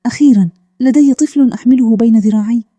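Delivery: plain reading voice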